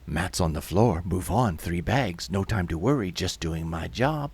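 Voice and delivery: low, impassive list of orders; indifferent official. Acted. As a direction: rattle off stock replies without feeling. Someone answers the shouting workers.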